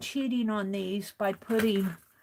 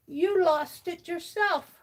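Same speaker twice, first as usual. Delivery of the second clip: a hint of Penelope, pitched higher, protesting; Penelope's answer; 6 semitones higher than usual. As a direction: lightly voice Penelope talking back. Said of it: "She said".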